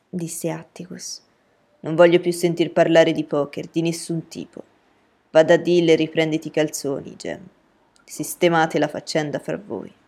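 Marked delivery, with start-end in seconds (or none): 1.8-9.9 firm, louder order from a father; stern father. A narrator's reading. Atticus forbidding his children any more poker, stern father. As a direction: lay down the law to the children.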